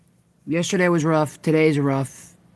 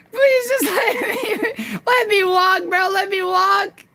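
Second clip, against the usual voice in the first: high pleading whine. Real, not acted. Begging in game, laughing.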